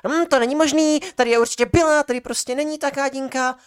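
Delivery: very high, loud indignant lab assistant; shrill mocking lab assistant. Lab assistant insisting the beaker was there, very high and loud. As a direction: ham up her outraged insistence.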